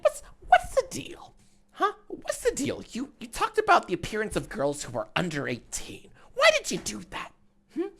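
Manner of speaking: high-pitched fake celebrity interviewer grilling a guest; Jiminy Glick impression. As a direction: squeak out gossipy questions like a pushy talk-show host. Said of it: "I don't know why I'm doing a Jiminy Glick impression".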